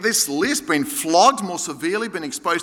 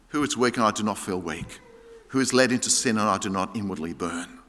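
low, soft, heartfelt reading; subdued, sincere and low; quiet earnest burden; 12 semitones lower, 5 dB quieter than usual. Acted. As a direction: voice a deep care for the weak, softly. Quotes Paul's burden for the weak.

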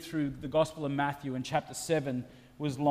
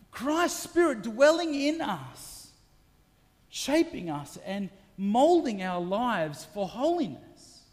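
full-force preaching, higher and louder, slowing on key words; building preaching; 7 semitones higher, 3 dB louder than usual. Acted. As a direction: build to a fervent climax.

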